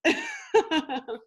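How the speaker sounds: a high, bubbly giggle; embarrassed laugh. Real, not acted. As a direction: laugh at your own mistake. Laughing at own flub.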